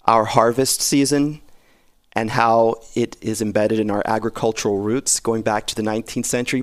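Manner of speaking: usual speaking voice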